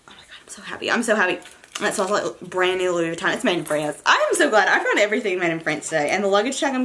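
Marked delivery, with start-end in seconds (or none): 0.1-0.9 whispered, much softer, real giddy joy. Real, not acted. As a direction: breathe the words out in a secret thrill. Whispered joy, then repeated plainly.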